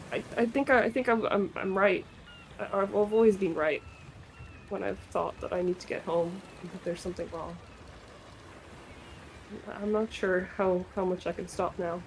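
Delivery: low, soft and halting; hesitant, hushed admission; subdued confession. Acted. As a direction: say it quietly, feeling your way to an apology. In-character apology, subdued and hesitant.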